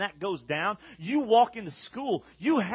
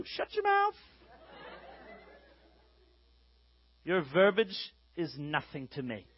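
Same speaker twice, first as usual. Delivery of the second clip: mock snob; 5 semitones lower, 7 dB quieter than usual. Mock arrogant student dismissing others.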